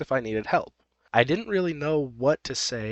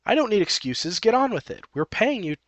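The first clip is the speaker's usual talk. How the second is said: imperious, higher-pitched acted impatience; bossy demand; 4 semitones higher than usual. Narrator voices Happy ordering OP to work, bossy. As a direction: order the hired help about, pitched up.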